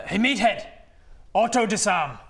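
loud, high enemy shout; shouted command. Acted. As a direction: bellow an order at an opponent. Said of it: "this one turns to you and shouts".

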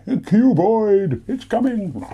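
spooky, dramatic alien warning. Pretend. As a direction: announce it like an alien invasion. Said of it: "That's kind of what that sounds like".

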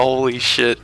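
usual speaking voice

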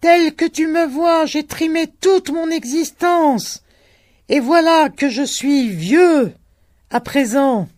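high, loud lament; old man shouting. A narrator's reading.

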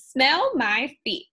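slow, loud, leering Smell my feet; mocking witch. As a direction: drag out 'Smell my feet' like a gloating witch. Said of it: "cracked O-, Ophelia".

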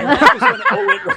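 whole line laughed through, high and loud; real laughter through the words; laughing. Real, not acted. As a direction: crack up while saying it. Laughing at his own punchline.

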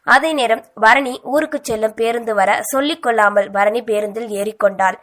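usual speaking voice

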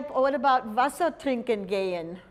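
Wasser trinken gehen said in German; German phrase. Shown as a demonstration. Teacher quizzing class with Wasser trinken gehen in German. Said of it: "What do you think that could be?".

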